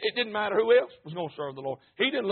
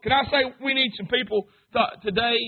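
normal talking voice

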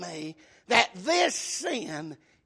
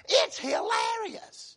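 high, drawn-out delight; amused exclamation; 11 semitones higher, 3 dB quieter, slower than usual. Acted. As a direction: stretch it out, pitched high and tickled. Gleefully voices what people say.

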